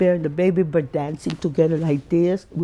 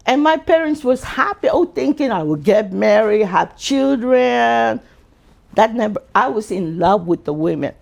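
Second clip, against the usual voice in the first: ordinary delivery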